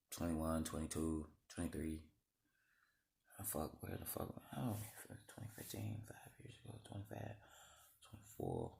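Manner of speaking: very soft, half-whispered counting; muttering to herself. Real, not acted. Working out years to herself.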